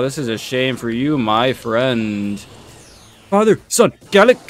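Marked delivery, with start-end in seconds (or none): 3.3-4.4 louder, higher blurt; callout. Real, not acted. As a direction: shout the attack name. Attack callout.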